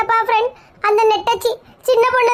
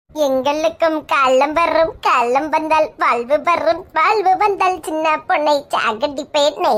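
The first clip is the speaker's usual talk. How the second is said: slow, low, grand revenge oath; slow, hammed-up vengeful chant; mock dramatic vow; 4 semitones lower, much slower than usual. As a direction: intone it like a film villain swearing revenge.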